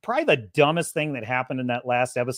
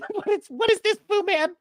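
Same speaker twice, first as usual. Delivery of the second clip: high laughing question; giggling what is this; laughing disbelief; 14 semitones higher than usual. Real, not acted. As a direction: squeak it out through laughs. Laughing at the character.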